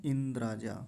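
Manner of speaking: 'इंद्राजा' with an n before d; nasal slipped into 'इंद्राजा'; common mistake. Shown as a demonstration. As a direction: say 'इंद्राजा' as in-draa-jaa. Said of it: "कुछ लोग क्या उच्चारण करते हैं".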